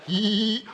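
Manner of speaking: high, drawn-out mock squeal; squeezed liver squeal. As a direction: comically imitate a liver being squished. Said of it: "on the inside, your liver's like".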